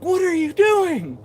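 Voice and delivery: high, loud imitation of an appalled viewer; exaggerated incredulous outburst; mock alarmed challenge. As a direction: yell it like an onlooker who can't believe it. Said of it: "and they're like".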